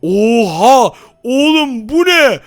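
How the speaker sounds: high, loud amazed cry; surprised. Real, not acted. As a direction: cry out in astonishment. Discovers walking on water.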